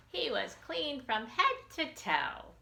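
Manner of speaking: plain reading voice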